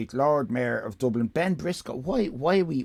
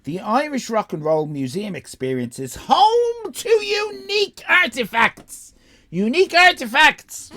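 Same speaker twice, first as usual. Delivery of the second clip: loud, high, stretched advert voice; hammed-up ad read; 12 semitones higher, 8 dB louder than usual. Mocking the museum's website blurb, grand advert voice. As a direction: sell the museum like a cheesy promo.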